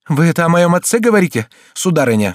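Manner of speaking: high, loud, outraged question; indignant cry. A narrator's reading. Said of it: "вскричал он". (возмущённо вскричать)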